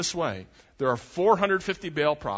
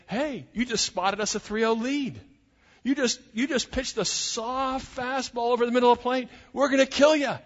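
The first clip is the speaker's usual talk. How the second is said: cocky prophets taunting; 9 semitones higher than usual. Acted. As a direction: crow it like a sure winner. Baal prophets boasting at Elijah.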